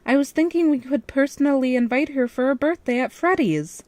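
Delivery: excited squeal about the party; squealing excitedly. A narrator's reading. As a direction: gush it out, barely containing joy. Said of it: "I squealed excitedly".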